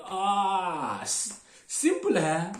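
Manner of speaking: loud, high, drawn-out crowd reaction; mock realization. Bus crowd reacts once it understands.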